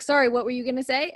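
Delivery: normal talking voice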